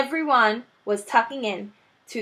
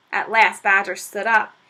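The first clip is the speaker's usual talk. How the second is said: plain reading voice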